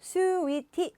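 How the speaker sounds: sweetie high with stress on SWEE; first-syllable stress. Shown as a demonstration. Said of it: "앞에 좀 강세".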